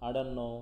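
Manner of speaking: "don't know" joined as "dunno"; T dropped. Shown as a demonstration. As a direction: run don't into know without the T. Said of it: "ঠ আসতেছে না".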